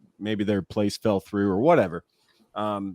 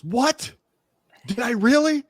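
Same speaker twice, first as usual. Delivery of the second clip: high-pitched mock surprise; startled lady; 14 semitones higher, 3 dB louder, slower than usual. Lady turning back to prankster, imitated startled question. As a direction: imitate a flustered passer-by, pitch way up.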